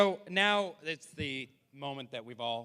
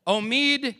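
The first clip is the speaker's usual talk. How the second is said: raised announcer voice building to a loud shout; announcer introduction; 4 semitones higher, 6 dB louder than usual. Real, not acted. Emcee introducing a great comic, big announcer build. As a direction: build up to a belted name.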